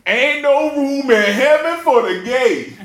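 hammed-up sidewalk sermon into a speaker; speaker preacher. Old street preacher preaching at passersby, lightly mocked. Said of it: "And what is he saying?".

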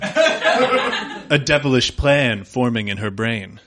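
ordinary delivery

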